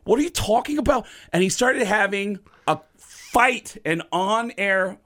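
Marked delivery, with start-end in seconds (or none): 0.0-1.1 indignant Lars. Retold or imitated. Lars arguing with fake Gene.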